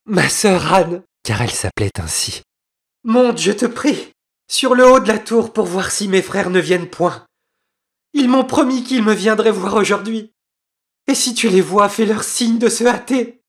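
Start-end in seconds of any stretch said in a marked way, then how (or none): 0.1-1.0 high, louder call to her sister; urgent wife. Acted. 3.0-13.4 very high-pitched pleading; urgent wife. Acted. Wife begs sister for help.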